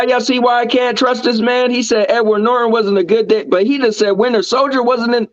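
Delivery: high, worked-up disbelief; mock outrage. Real, not acted. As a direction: call him out in playful outrage. Outrage at ranking.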